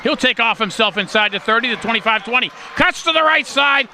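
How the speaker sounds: excited call, pitched high and sustained; live play-by-play. Real, not acted. Announcer calling the quarterback's scramble, excited high play-by-play. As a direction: ride the scramble up high, then ease down.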